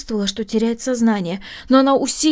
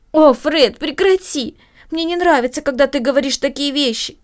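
louder and higher, protesting; upset plea; 6 semitones higher, 4 dB louder than usual. Acted. Sister begging Fred to stop talking, upset and loud. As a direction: beg him to stop, upset. Amy begs brother to stop.

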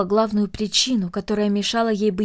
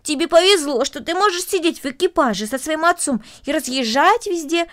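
higher, animated marveling; envious excitement; 6 semitones higher, 5 dB louder than usual. Acted. Boy marveling at the girl's carriage rides, excited. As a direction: gush over someone else's luck.

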